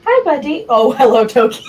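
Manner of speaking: high cooing voice for the cat; pet voice. Real, not acted. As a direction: coo sweetly at your pet. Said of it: "You were using the pet voice".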